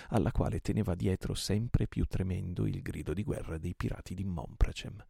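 usual speaking voice

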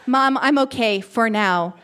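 ordinary delivery, nothing marked

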